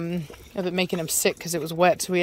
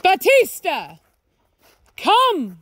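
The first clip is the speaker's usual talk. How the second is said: high, loud call across the yard; shouting for the dog to come; 10 semitones higher, 5 dB louder, much slower than usual. Real, not acted. Calling dog from distance.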